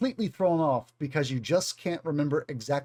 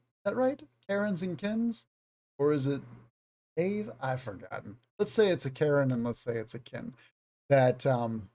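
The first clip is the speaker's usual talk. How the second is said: ordinary talk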